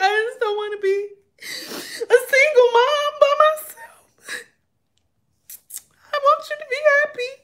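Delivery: high, hammed-up weepy lament; crying self-pity. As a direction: sob it out squeakily, playing up the misery. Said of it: "I started crying".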